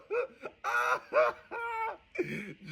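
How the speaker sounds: high, howling laughter. Real, not acted. Co-host howling at the judge's line, high laugh. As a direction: lose it in a high howl of laughter.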